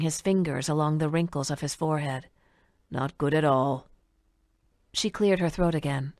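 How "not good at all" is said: put-on old man voice.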